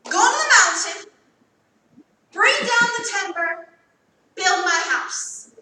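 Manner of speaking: commanding sermon cadence, slowing on build; preaching God's command. Acted. Preacher to congregation, proclaiming God's command. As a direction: proclaim it like the Lord's order.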